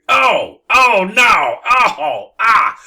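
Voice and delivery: loud, high wails of revulsion; horrified cries. Acted. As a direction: cry out in dawning disgust, hammed up. Kisser realizes in horror what he kissed.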